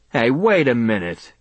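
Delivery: sharp call after him. A narrator's reading. Barber calling after the leaving customer, sharp. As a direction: stop him at the door, sharp. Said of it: "his voice called after me sharply".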